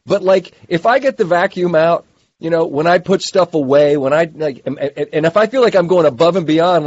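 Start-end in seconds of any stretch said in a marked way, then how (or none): none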